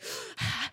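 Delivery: soft, breathed-out sigh; decompressing sigh. Shown as a demonstration. Taking a break from an emotional movie, demonstrative sigh. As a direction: let out a long breath to recover. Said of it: "like, decompress".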